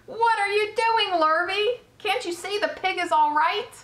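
high-pitched scolding questions; exasperated yelling. A narrator's reading. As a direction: berate the bungling helper. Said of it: "yelled Mister Zuckerman".

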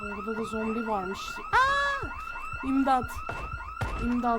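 high piercing panicked screams; screaming for help. Real, not acted. Gamer surrounded by zombies, screaming for help. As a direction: shriek for help as they close in.

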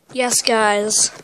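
normal talking voice

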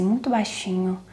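soft, shy near-whisper trailing into a whisper; tímida, quase sussurrando; timid quiet voice. Shown as a demonstration. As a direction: show how a timid beginner mumbles to camera. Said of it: "imagina você começar a falar assim".

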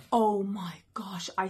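slow breathless whisper of amazement; awed whisper. Real, not acted. Mother opening a surprise gift box, awed whisper. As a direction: hush it out in wonder. Opens big box, gasps.

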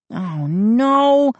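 high, alarmed oh no; boy seeing the gnomes again, dismayed; dismayed cry. A narrator's reading. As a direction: gasp it out in dismay. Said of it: "I cried".

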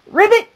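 a little frog croaking; high loud ribbit. Acted. The frog joins the animal chorus, high ribbit.

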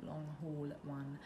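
ordinary delivery

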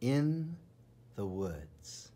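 ordinary delivery

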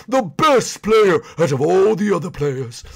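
lightly grand boast; a hint of a booming hero; mild godlike John Wick. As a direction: brag like a living legend. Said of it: "Your voice sounds like God himself".

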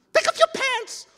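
very high, very loud shriek; acted hysterical kid; frantic scream. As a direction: scream the order in desperation. Crying Keoki insists frantically.

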